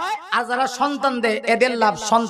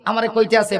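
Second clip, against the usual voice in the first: ordinary talk, nothing marked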